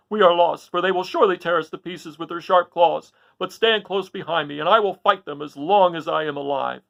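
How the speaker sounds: desperate resolve. Acted. The lion tells Dorothy to stand behind him, loud and desperate. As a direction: stand firm though doomed. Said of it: "the lion said to Dorothy".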